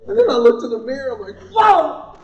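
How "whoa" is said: loud, high yelp of alarm; sudden high "whoa"; startled whoa. Retold or imitated. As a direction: recoil at your own reflection. Startled at own reflection in mirror.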